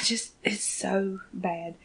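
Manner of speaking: breathy whisper, slowed right down; whispered despair. Real, not acted. Podcaster whispering in dismay over the episode. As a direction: barely breathe the words out in dismay. Anguished aside about the episode.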